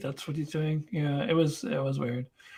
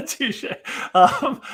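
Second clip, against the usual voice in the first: normal talking voice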